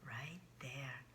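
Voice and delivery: whispered aside. Real, not acted. Pointing at the signature.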